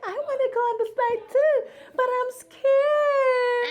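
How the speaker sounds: high, hammed-up scared bear voice, breaking into a cry; scared little bear. Mom voicing a scared bear for her son. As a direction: play the timid bear who wants a turn. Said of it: "he's like".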